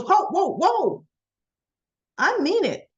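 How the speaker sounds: high, emphatic "stop right there"; acted protest. Summer cutting in on the plan talk, acted high protest. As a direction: cut in high and forceful. Acting Summer breaking in on others.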